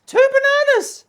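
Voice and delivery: shrieked out very high and loud; sudden piercing mock-excited yell of both words; comic shriek. Vlogger yelling a banana gag to viewers, comic shriek.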